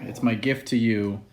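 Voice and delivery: usual speaking voice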